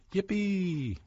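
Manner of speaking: high, gleeful yippee; a joyful squeal of delight; excited cheer. Acted. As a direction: cheer it like a happy chef. Max cheers in story.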